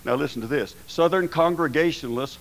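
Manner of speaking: normal talking voice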